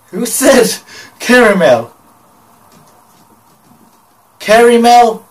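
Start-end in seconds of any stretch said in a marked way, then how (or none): 1.2-1.9 "caramel" as "KERRY-mel", pitched up; mock her "caramel", first syllable like "care"; incredulous raised "caramel", said "KERRY-mel". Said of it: "That's what she said". 4.4-5.2 high, loud "Caramel" as "KERRY-mel"; punched-up "Caramel", middle vowel turned to "ee"; caramel said her way. Retold or imitated. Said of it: "That's what she said".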